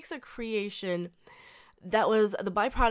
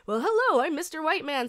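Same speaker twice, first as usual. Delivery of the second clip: Eddie Murphy; 4 semitones higher than usual. Retold or imitated. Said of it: "his very generic ... sort of accent".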